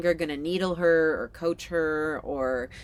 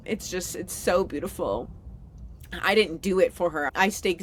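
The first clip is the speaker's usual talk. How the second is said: moved and proud, close to tears; choked up with pride, a small laugh breaking in; real, emotional; 3 semitones higher than usual. Real, not acted. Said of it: "getting emotional saying it".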